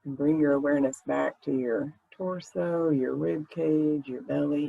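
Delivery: ordinary talk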